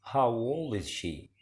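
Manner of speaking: slow, careful question for learners; slow model reading. Shown as a demonstration. Teacher modelling an English question slowly for students. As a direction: read the question slowly and clearly. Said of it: "كم عمرها؟".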